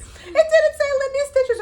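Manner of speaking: very high, loud, slow wail; outraged disbelief. Retold or imitated. Retold letdown at wrong package.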